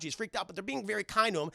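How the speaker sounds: usual speaking voice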